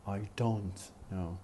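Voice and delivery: slow, with "don't" drawn out and full; stressed "don't". Shown as a demonstration. Teacher demonstrating the stressed negative, stressed "don't". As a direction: lean on "don't", let "know" drop. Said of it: "has to be stressed".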